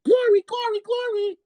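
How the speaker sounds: mock a little bird singing praise; chirping birdie. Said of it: "all the while chirping".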